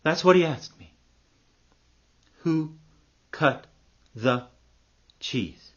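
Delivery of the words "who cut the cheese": each word set apart by a long pause; word-by-word repeat. Acted. Narrator repeats what the monk asked, word by word. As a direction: slowly space out WHO, CUT, THE, CHEESE.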